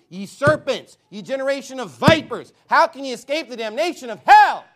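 loud, high preaching cry; fiery sermon denunciation; shouted rebuke. Acted. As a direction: blast it across the church. Preacher reads Christ's rebuke.

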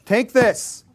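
hammed-up pushy door-knocker; pushy handout. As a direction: shove it at them with the voice. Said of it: "shoving it through the door".

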